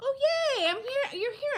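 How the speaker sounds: high, delighted greeting; excited. Real, not acted. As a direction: squeal with joy at seeing them. Greeting viewers joining live.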